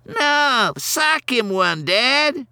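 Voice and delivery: put-on a spoiled kid urging his dad to fight; bratty kid. A narrator's reading. Ronnie goading his dad to hit Santa, high and bratty.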